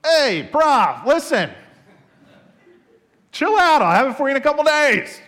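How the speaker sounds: high, loud, breezy brush-off; cocky student. Retold or imitated. Lazy student brushing off his professor, high and breezy. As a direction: toss it off like nothing's wrong.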